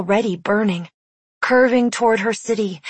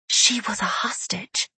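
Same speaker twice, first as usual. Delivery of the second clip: breathy horrified whisper; 5 dB quieter than usual. A narrator's reading. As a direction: hush it in dread. Horrified realization of capture.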